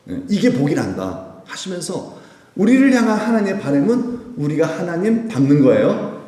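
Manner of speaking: usual speaking voice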